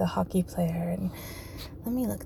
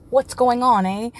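light Canadian accent, a bit louder, on falls; 4 semitones higher, 5 dB louder than usual. Shown as a demonstration. Repeating his Canadian impression, light Canadian accent. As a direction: say it like a friendly Canadian. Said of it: "There's my Canadian accent".

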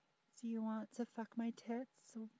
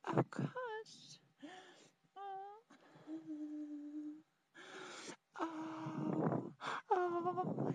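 orgasm cries; 7 semitones higher, slower than usual. Acted. Orgasm peak, high cries.

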